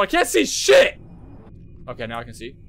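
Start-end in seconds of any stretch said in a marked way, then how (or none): 0.0-1.0 high, loud burst of exasperation; frustrated shout. Real, not acted. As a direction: yell it, rising into the last word. Frustrated at dark game room.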